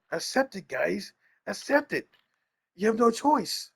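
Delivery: high-pitched mock preaching at fans; Marlon. Telling fans they have no choice, mock insistent. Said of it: "like Marlon".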